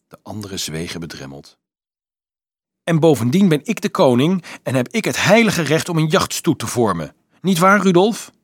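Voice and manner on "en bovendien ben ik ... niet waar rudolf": loud, high self-important claim; shrieking on. A narrator's reading. Little Carolus claiming his right as king, shrieking on. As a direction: insist with royal indignation. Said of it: "gilde Carolus".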